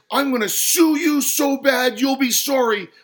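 high, loud, sustained rant; furious, threatening tirade; spitting anger. A narrator's reading. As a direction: rage on at full volume. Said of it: "spat the customer".

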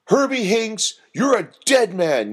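high, loud threat; angry yell. A narrator's reading. Doreen turning on the giggling prankster, yelling. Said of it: "yelled Doreen".

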